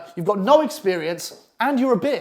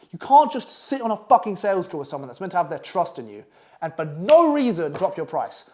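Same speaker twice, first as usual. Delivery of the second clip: normal talking voice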